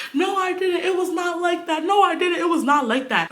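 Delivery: high-pitched mimicked protest; girlfriend's denial. Girlfriend denying cheating to boyfriend, high mock protest. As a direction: imitate her insisting in a high voice.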